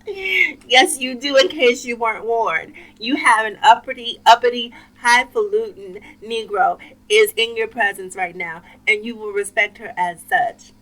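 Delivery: hammed-up indignant announcement; mock proud proclamation. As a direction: proclaim it grandly, like announcing royalty. Mock proclamation answering the man.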